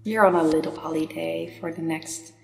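calm meditation guide, long pauses between phrases; unhurried, soothing meditation voice; guided meditation. Real, not acted. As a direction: lead the listener gently inward, leaving space after each phrase.

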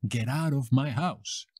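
ordinary delivery

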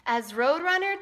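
ordinary delivery

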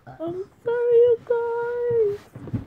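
very high squeaky sorry; squeaky apology. Real, not acted. Streamer apologizes to viewers in a very high squeak.

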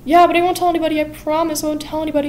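high and hurried, begging not to be hurt; mock panicked promise; frightened pleading. Joanna begs for her life.

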